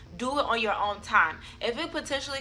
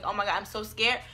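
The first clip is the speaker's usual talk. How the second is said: usual speaking voice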